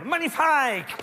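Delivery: high, mock Portuguese-accented gush; Christina Cordula. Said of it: "un, un accent portugais". (enthousiasme aigu, accent portugais)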